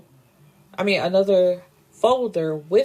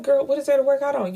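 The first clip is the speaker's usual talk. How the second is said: sassy scolding; 7 semitones higher, faster than usual. Real, not acted. Vlogger scolding character.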